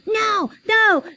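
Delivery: put-on shrieking, crying child's tantrum, high and loud; hollering tantrum. A narrator's reading. Little brother in the shoe store hollers a crying, furious refusal. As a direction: holler it through tears like a furious toddler. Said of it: "he hollered".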